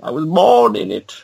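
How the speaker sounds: high, muffled masked-villain voice; theatrical villain impression, pitched high and stretched out at the end; Bane. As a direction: monologue grandly like a masked supervillain. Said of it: "I had the best Bane accent".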